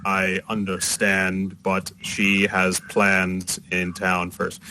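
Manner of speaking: slow, stiff machine-person voice; warforged. Acted. Warforged explaining Bethany's plans to the girl, slow and stiff. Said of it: "and the warforged".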